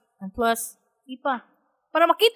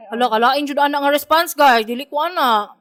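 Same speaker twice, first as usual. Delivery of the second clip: ordinary talk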